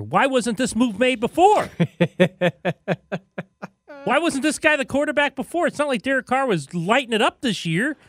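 high-pitched, exasperated rhetorical questions; incredulous. Real, not acted. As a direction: voice leaping up in disbelief. Incredulous rant about late quarterback switch.

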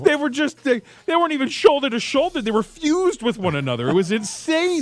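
high-pitched amazed retelling; excited. Real, not acted. Excited account of crowded stadium.

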